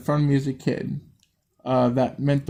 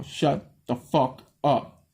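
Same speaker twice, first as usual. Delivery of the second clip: slow, each word struck apart; Shut, the, fuck, up landed like blows; one word per swing; each word hit; much slower than usual. Acted. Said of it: "with each word of that sentence, she hit the wife".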